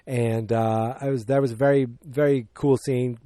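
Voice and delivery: ordinary talk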